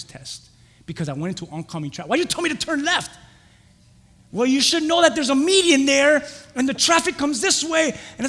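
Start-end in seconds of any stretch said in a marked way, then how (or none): 2.1-3.2 high-pitched indignant question; acted-out aggrieved complaint; retold protest. Acts out arguing with examiner. 4.3-7.9 high, loud retold rebuke; acted-out heated argument; examiner's scolding. Acts out examiner's scolding reply.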